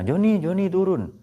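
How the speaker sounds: a hint of a doctor calling up. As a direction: coax the patient down off the table. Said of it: "Kata dokternya".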